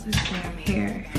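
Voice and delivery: normal talking voice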